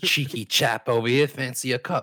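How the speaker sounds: loud, high hammed-up Cockney; cheeky London geezer voice, played up; mock British accent. Showing off a Statham-style British accent to friends. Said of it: "That sounds like Jason Statham".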